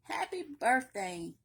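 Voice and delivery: plain reading voice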